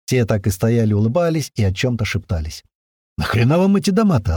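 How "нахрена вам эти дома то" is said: higher, bewildered question; dumbfounded. A narrator's reading. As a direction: ask in baffled surprise, pitch raised. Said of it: "обалдел я".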